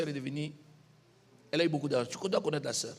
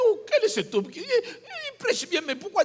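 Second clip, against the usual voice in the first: mock amazement; 11 semitones higher than usual. Imitates listener's high-pitched wonder.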